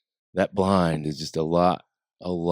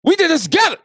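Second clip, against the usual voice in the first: laughing outrage; 8 dB louder than usual. Real, not acted. Laughing outraged mock reaction.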